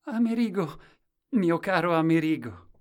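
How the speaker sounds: high, loud happy cry; loud, high-pitched embrace greeting; joyful shout. A narrator's reading. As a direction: cry out overjoyed to a friend. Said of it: "lo abbracciò e gridò".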